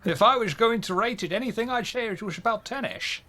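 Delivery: hammed-up Connery Russian accent; Sean Connery Russian accent. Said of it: "I'll do Sean Connery's Russian accent".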